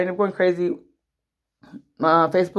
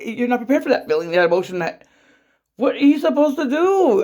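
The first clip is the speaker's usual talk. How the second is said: usual speaking voice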